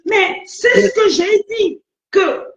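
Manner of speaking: high, drawn-out exasperated protest; insistent. Real, not acted. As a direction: insist slowly, pitched well up. Restating point after being challenged.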